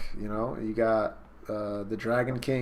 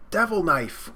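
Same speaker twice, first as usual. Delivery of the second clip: higher-pitched mock battle cry; acted attack call; 8 semitones higher than usual. Fighter answers with his own attack, mock high call. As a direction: announce the move in an acted higher voice.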